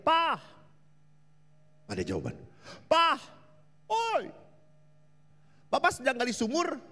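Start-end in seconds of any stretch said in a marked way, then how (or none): 0.0-0.4 high call down the well. Retold or imitated. Child calls papa down the dark well, loud high call. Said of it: "Dia teriak". 2.9-6.8 put-on high, loud child's call. Child asks papa in the well, high loud call.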